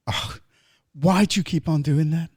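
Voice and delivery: ordinary delivery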